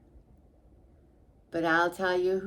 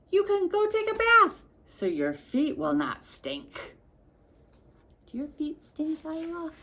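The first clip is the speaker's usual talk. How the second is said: teasing; 8 semitones higher than usual. Acted. Teasing child about stinky feet.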